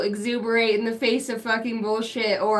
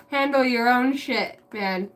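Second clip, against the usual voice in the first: ordinary talk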